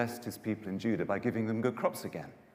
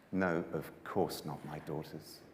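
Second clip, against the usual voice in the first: soft sad refusal; 6 semitones lower, 7 dB quieter than usual. Acted. Naomi sadly refusing her daughters.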